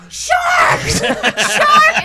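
high, loud warning cry; mock alarmed yell. Grandma on balcony warning kids in water, yelling. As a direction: holler it like a panicking grandma. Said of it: "Yelling at sharks".

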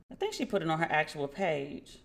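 usual speaking voice

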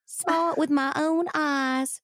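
put-on slow, high little old lady drawl; prim Southern granny voice; bad Southern accent. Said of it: "bad Southern accent acting".

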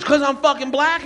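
high indignant yelp; hammed-up high outrage; mock outraged comic. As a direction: spit it out, offended. Chappelle voiced in argument.

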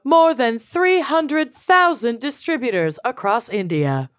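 usual speaking voice

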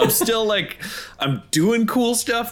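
put-on high, woozy, punch-drunk voice; dazed fighter. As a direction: sound concussed but still cocky. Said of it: "realize I've had a head injury".

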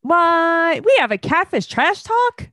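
high, bright disbelief on every word; real surprise, voice jumping way up; genuinely surprised. Real, not acted. Learns of a new podcast.

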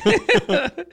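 squeaky high laugh; high squealing laugh. Real, not acted. Laugh after joke.

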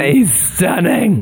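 slow, drawn-out gushing aunt; squealing baby talk aunt. Acted. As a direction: gush over him like an auntie. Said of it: "do an aunt impression".

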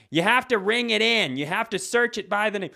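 high, loud mock nagging; scolding old woman. Old woman correcting a self-checkout customer, loud mock nagging. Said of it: "this old woman always comes over".

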